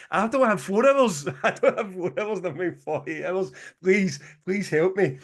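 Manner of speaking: high-pitched, exasperated mock plea; retold panic, high and insistent; desperate pleading. Retelling his plea at the consulate.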